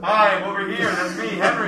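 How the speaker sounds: a laughing call from a distance; far from mic. Shown as a demonstration. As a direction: call out from across the room. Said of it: "showing he is far away".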